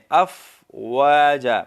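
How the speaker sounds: افواجا with a still ف and a long final ا; correct pronunciation. Shown as a demonstration. Teacher models افواجا for students. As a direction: stretch افواجا, keeping the ف silent of vowel. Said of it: "فا کو ہم سکون کے ساتھ ادا کریں".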